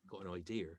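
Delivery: low, soft West Country accent; lightly put-on Bristol accent. Shown as a demonstration. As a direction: slip briefly into a Bristolian burr. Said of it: "I know people from Bristol who talk".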